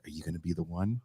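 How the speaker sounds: quiet deadpan. Retold or imitated. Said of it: "I'm like".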